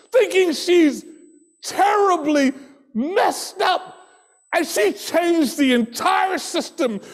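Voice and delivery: rising, shouting sermon voice; preaching. Acted. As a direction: preach it out with full force. Preaching at full force.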